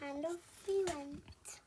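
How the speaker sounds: soft, slow, high lilt. Shown as a demonstration. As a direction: hush it down slowly. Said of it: "narration retake".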